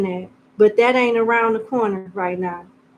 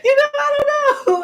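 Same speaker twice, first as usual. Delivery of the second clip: laughing; 3 dB louder than usual. Real, not acted. Laughing at her own accent.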